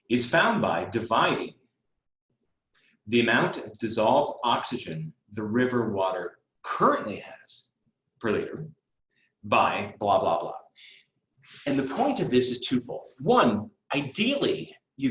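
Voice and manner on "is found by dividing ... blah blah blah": slow, deliberate phrase-by-phrase reading; idiot speed. Shown as a demonstration. Tutor demonstrates slow reading of a word problem, idiot speed. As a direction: take each chunk separately, long gaps between. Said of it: "reading the problem so slowly".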